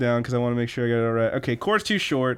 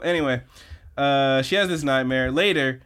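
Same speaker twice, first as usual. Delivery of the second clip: normal talking voice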